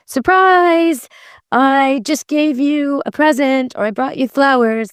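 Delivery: high, singsong mock surprise; happy excited. Shown as a demonstration. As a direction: act out a delighted gift-giver, pitched well up. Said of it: "That's happy excited".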